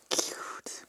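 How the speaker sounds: a tiny breathy coo; soft whisper of delight; whispered cute. Real, not acted. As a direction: whisper it fondly. Cooing over a sticker.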